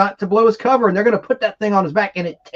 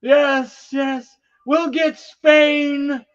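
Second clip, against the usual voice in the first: higher, slow devoted murmur to the master; possessed henchman; 8 semitones higher, much slower than usual. Retold or imitated. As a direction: answer the master like an entranced servant. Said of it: "but he goes".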